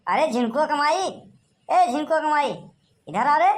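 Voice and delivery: high-pitched insistent yell; shouting for someone. Acted. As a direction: call out to summon someone from the house. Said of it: "काहे चिल्लात है आप".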